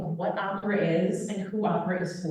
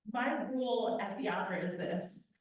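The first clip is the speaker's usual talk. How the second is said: ordinary talk, nothing marked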